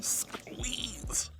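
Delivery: breathy whisper of a hug; whispered squeeze. Real, not acted. Giving a pretend hug.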